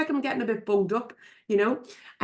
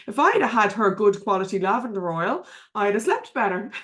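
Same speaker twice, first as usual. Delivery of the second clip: normal talking voice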